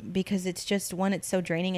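normal talking voice

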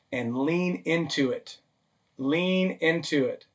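slow, soft and tender; softly urging. Real, not acted. Pastor gently urging his listeners, slow and soft. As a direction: ease the words out gently, like an invitation.

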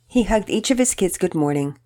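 ordinary delivery

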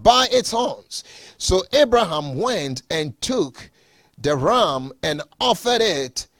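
measured, solemn Bible reading; scripture reading. Acted. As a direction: read the passage with reverent weight. Reads Genesis passage aloud.

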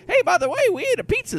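high, loud hammed-up ad voice; mock pitchman. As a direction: ham up a cheesy sponsor plug. Mocking a sponsor's promo line.

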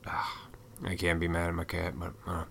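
whispered, forgiving the cat. Real, not acted. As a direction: hush it to yourself, the anger already gone. Calms down after the cat.

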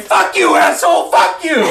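very high screaming fury; hammed-up furious shrieking; drunken rage. A narrator's reading. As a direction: scream abuse like a small raging drunk. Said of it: "he's really drunk".

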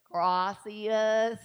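gracias drawn out in a thick Southern accent; Southern-accented Spanish. Shown as a demonstration. Preacher showing the congregation her Spanish, exaggerated Southern accent. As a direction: stretch gracias slowly with a Southern twang. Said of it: "with my Southern accent".

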